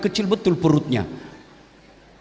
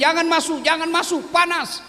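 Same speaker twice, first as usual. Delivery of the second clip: higher, warning shout; mock yell; 9 semitones higher than usual. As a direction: call a panicked warning. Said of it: "Dia teriak".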